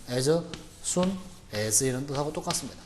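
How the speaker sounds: ordinary delivery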